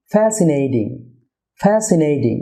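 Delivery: "ফ্যাসিনেটিং" as FAE-si-ney-ding; correct pronunciation. Shown as a demonstration. Teacher models for viewers, "ফ্যাসিনেটিং" with D for T. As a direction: soften the T of "ফ্যাসিনেটিং" to D. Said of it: "টি এর উচ্চারণ ডি হবে".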